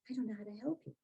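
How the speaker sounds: hushed, softly voiced confession; helpless admission. Therapist admitting to patient she can't help, hushed helpless admission. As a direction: drop to a soft, apologetic voice.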